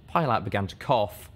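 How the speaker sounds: plain reading voice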